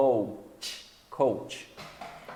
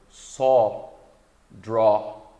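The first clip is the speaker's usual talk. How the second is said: usual speaking voice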